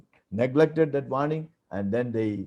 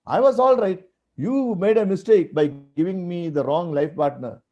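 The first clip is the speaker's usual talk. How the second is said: blaming God; 6 semitones higher than usual. Retold or imitated. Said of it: "He was hitting God".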